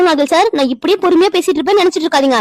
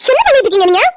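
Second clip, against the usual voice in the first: high, louder accusing question; shocked demand; 6 semitones higher, 3 dB louder, faster than usual. Acted. Wife confronting husband over her sister, outraged demand.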